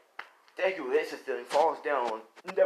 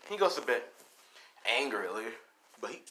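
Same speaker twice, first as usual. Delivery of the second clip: ordinary delivery, nothing marked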